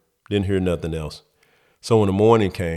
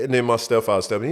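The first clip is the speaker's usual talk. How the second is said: ordinary talk, nothing marked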